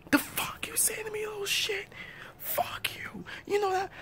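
high-pitched hissed insult; whispered high snap. Acted. Arguing parent snapping back, high whisper. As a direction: whisper it sharply.

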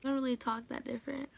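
talking with retainers; slight retainer lisp; retainers in mouth. Real, not acted. Seen 0: Points at retainers on teeth, removes them at 13.9.

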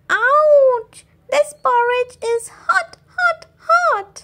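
put-on high squeaky baby bear yelp. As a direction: cry out like a little bear burnt by hot porridge. Baby Bear burnt by porridge.

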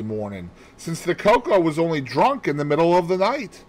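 high, loud, eager explaining; triumphant amateur sleuth; excited deduction. A narrator's reading. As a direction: spill out the theory excitedly. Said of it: "My pent-up excitement burst forth".

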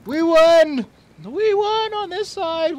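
high, loud victory shout; triumphant cheer. Real, not acted. A player celebrating holding one side of the wall, cheering loudly. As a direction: whoop with relief at the win.

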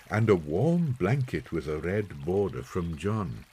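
usual speaking voice

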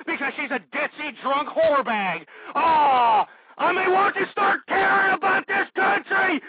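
high, loud ranting yell, slowing on the blame; a furious screamed tirade that stretches out each "it's your fault"; furious shout. Acted. Furious rant at the audience.

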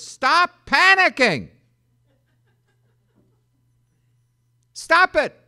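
loud, high, slow Stop panicking; shouted command. Acted. Angel shouting at frightened people, loud and high. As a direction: bellow it like an angel from heaven. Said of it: "the first word is".

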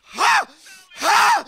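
high, loud staged "Ha!"; forced mock laugh. Mocking Stim with a fake laugh, forced laugh. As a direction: bark out a fake laugh on command.

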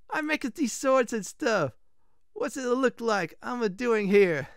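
high, exasperated mock-Italian; bad Italian accent. Blacksmith explaining his job, bad Italian accent. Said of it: "this is gonna be very bad Italian accent".

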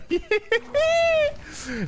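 very high squealing laugh; shrill little cackle; high-pitched giggle. Real, not acted. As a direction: let out a squeaky, drawn-out giggle. Laughs as Zeri is called.

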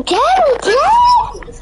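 excited yell. Real, not acted. Gamer yelling at friend to get the enemy, excited shout. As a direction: cheer them on mid-fight. Cheering during a Fortnite fight.

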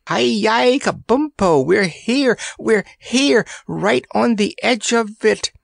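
high-pitched excited calling; excited shout. A narrator's reading. Randy calling Kabumpo to the desert's edge, excited shout. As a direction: call out the discovery at the top of the range. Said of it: "he shouted vociferously".

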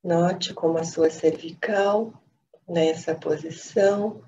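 calm, unhurried meditation voice with long pauses; guided meditation. Acted. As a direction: guide them gently into stillness, phrase by phrase.

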